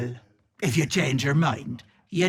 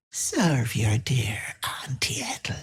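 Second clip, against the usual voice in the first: hushed witchy whisper rising on Auntie Ethel; whispered sinister command; creepy whisper. Acted. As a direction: breathe the command like a curse.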